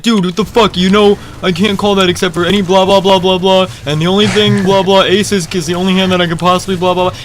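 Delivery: heated retold complaint rattling through the blahs; hammed-up outrage, pitched high; mock angry rant. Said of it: "he gets so mad".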